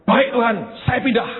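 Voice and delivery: high, loud, eager cry of obedience; eager loud shout. Retold or imitated. Preacher to congregation, hammed-up eager shout. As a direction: shout it out with zeal.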